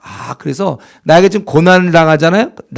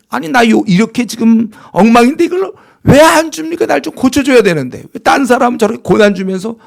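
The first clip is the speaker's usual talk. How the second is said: higher, louder aggrieved whine; complaining to God; 8 semitones higher, 5 dB louder than usual. Acted. Believer protesting to God for withholding trials, aggrieved and loud. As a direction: grumble as if cheated of hardship. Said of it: "하고 원망할까요".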